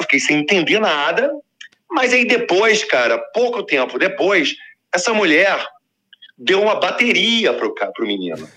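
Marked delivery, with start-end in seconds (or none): none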